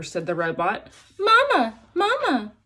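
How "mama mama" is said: put-on very high, tiny baby-bird voice; tiny gosling peeping for mama. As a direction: squeak it like a newborn chick. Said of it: "peeped the gosling".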